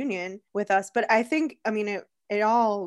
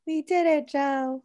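Kamala impression; 7 semitones higher, slower than usual. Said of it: "when Kamala does".

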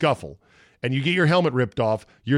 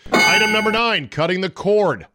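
announcer call; 6 semitones higher, 5 dB louder than usual. Acted. Announces a segment title.

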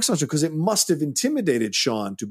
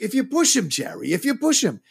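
raised-pitch quoted warning to Jerry; acted-out argument; 10 semitones higher than usual. Film fan quoting Sean's argument with Jerry, imitated heated warning. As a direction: imitate a heated movie character, pitch jumping up. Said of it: "where he's like".

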